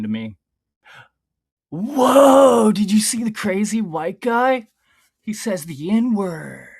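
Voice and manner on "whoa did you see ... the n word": high, loud mock amazed gasp; mock shocked reactor. Reading script to chat, mock amazed shout. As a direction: ham up a wide-eyed shocked viewer.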